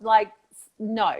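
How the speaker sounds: ordinary talk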